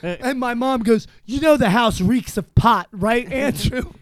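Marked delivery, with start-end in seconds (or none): 1.2-3.9 louder, higher mock-stern mother calling him out; a hint of a stern mother catching him; mom's accusing call. Mom confronts him, house reeks.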